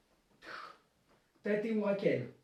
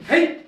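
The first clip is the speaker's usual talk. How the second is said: sharp loud kiai yell; kiai shout; 6 semitones higher, 10 dB louder than usual. Real, not acted. Karate teacher shouting the kiai with students. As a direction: explode with a short battle cry.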